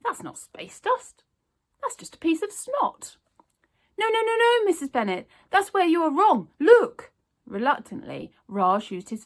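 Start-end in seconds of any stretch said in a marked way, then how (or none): 0.0-3.2 put-on old lady voice. A narrator's reading. 4.0-7.1 high, loud frantic denial; desperate insisting. A narrator's reading. Newsagent insists to Miss Bennet it's space dust, frantic.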